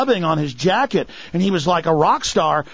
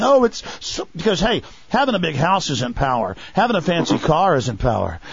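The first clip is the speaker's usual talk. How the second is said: ordinary talk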